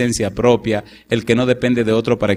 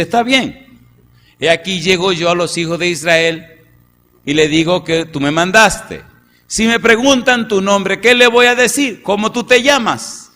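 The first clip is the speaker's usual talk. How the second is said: Moses pleading; 6 semitones higher, 4 dB louder than usual. Acted. Preacher voices Moses pleading to God.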